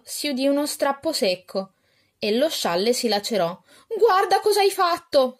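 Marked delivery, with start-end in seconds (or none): 4.0-5.4 high, loud accusing blurt; angry outburst. Acted. Araminta blurts at her sister over the torn shawl, high and loud. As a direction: blame her sister in a sudden high burst. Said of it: "sbottò Araminta".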